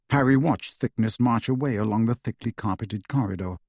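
ordinary delivery, nothing marked

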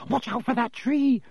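high-pitched alarmed warning; urgent high shout; bellow. A narrator's reading. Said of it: "Harry bellowed".

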